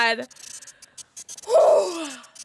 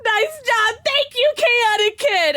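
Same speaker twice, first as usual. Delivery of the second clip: higher, teary grateful voice; emotional thanks; 9 semitones higher than usual. Real, not acted. Streamer thanking the helper after the win, teary high thanks. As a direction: thank them, voice full of emotion.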